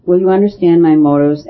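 ordinary delivery, nothing marked

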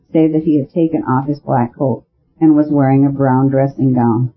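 ordinary delivery, nothing marked

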